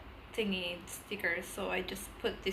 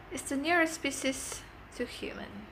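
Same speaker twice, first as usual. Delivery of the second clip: ordinary talk, nothing marked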